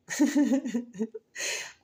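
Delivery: high giggle; high laugh. Real, not acted. Joking to Virgo viewers, a high giggle. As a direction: let a high laugh slip.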